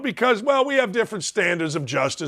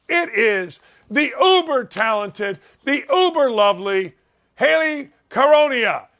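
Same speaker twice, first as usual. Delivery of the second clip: announcer introduction; 5 semitones higher than usual. Pretend. Host bringing on Haley as guest, grand announcer introduction. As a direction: present the guest with a flourish.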